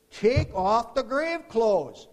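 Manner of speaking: loud, high, slow command; commanding call. Acted. Preacher voices Jesus to bystanders at Lazarus's grave, commanding call. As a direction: call it out word by word, drawn out.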